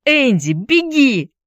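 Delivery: high, drawn-out warning cry; urgent cry to run away. A narrator's reading. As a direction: shout a desperate warning, high and slow. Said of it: "закричала Дженни".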